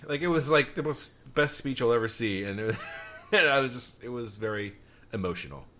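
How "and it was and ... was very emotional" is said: normal talking voice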